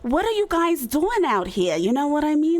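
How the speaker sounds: loud, high New York outburst; hammed-up New Yorker, pitched well up; New York accent. Imitating a New Yorker for friends, loud New York accent. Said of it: "if I can try a New York".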